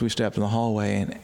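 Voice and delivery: usual speaking voice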